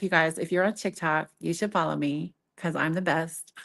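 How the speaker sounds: ordinary talk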